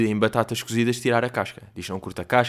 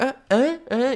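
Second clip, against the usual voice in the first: imitating grandma not hearing; 13 semitones higher, slower than usual. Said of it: "E mesmo se fizer tipo".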